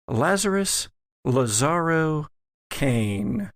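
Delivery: ordinary talk, nothing marked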